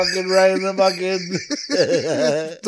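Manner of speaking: high, loud laughing yells; excited shout. Retold or imitated. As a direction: hype it up, laughing. Acting out stabbing joke.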